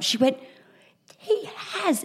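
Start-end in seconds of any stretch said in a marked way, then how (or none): 1.2-2.0 breathy secret whisper. Retold or imitated. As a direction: whisper it, high and hushed. Friend whispers agreement.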